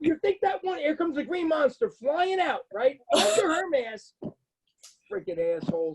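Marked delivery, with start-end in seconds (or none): 0.0-4.1 high, slower mocking delivery; taunting the smoker in line; sarcastic jab. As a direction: lay the sarcasm on thick. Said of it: "I went".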